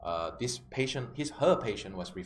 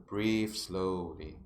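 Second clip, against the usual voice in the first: slow, lower delivery; 4 semitones lower, much slower than usual. Shown as a demonstration. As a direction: draw out the words like slow breathing. Acts out sinking into depression.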